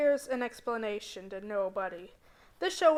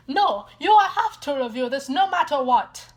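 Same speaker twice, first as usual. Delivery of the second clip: louder demanding Italian-tinged captor; insistent shout; 4 semitones higher, 10 dB louder than usual. Acted. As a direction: command it firmly.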